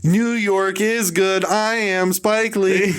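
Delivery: high, slow, hammed-up Spike Lee voice; high, slow mock director boast. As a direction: parody him announcing himself, dragging every word.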